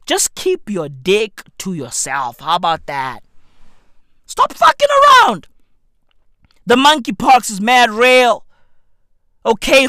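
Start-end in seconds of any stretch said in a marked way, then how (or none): 4.2-5.5 high, loud scolding yell; high-pitched angry outburst; exasperated shout. Real, not acted. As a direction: scream it as a fed-up order. Same demand repeated as a yell.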